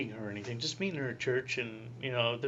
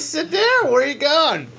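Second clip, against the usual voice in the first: much higher and louder, calling him back; insistent call back; 13 semitones higher, 13 dB louder, slower than usual. Retold or imitated. Vocation director to Pat leaving, loud high call back.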